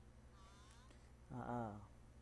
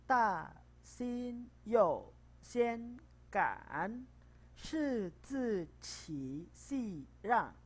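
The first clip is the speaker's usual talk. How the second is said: very slow, higher recitation, syllable by syllable; slow reading; 8 semitones higher, 6 dB louder, much slower than usual. Shown as a demonstration. Teacher rereads the paragraph slowly for the class. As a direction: read it again very slowly. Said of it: "pelan-pelan ya".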